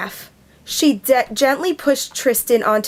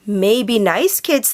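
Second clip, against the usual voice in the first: usual speaking voice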